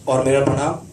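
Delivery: ordinary talk, nothing marked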